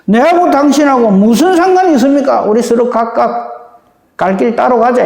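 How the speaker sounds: scornful refusal, go your own way; defiant demon. Acted. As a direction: hurl it back defiantly. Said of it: "마귀가 하는 소리다". (마귀처럼 반항적으로)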